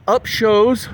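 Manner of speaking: usual speaking voice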